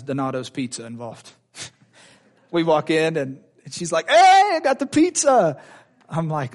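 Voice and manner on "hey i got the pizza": high, loud, drawn-out holler; mock sing-out announcing food's arrival; hammed-up, high-pitched wife impression; imitating excited wife.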